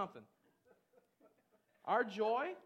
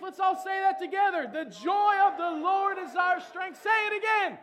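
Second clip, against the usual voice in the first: loud, high shouted praise; full-force preaching; 8 semitones higher, 14 dB louder, slower than usual. Real, not acted. Preacher rousing the standing congregation, shouting praise. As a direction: whip the congregation into joy.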